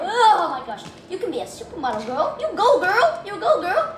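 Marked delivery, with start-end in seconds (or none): 2.4-4.0 sassy cheer. Pretend. Cheering a model figure.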